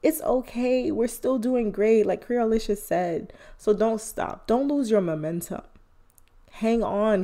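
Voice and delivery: ordinary delivery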